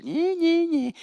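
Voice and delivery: mock a sibling with a sing-song nyeh. Mocking taunt at the band director.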